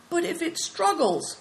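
high, uncertain question; worried objection. Acted. Companion doubting Big Tiger's plan, worried and high. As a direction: raise a nervous doubt, pitched high.

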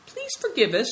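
high pleading cry; cried apology. Acted.

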